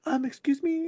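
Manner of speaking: high, timid customer-service voice; meek polite voice. Retold or imitated. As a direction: ask sheepishly, far from yelling. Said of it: "I whispered at them".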